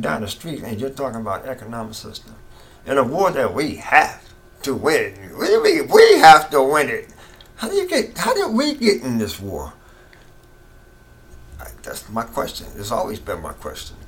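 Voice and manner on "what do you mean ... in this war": much higher and louder, indignant challenge; outraged. Real, not acted. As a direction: demand an answer, fired up. Outraged at "we have to win.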